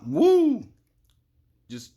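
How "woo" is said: sudden high loud whoop; excited whoop. Real, not acted. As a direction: burst out cheering. Sudden cheer mid-sentence.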